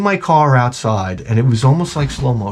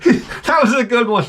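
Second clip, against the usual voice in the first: high mocking laugh into words; salesman laughing; 10 semitones higher than usual. Dealership salesman laughing at a buyer, mock. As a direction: cackle then high mock compliment. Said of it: "They're like".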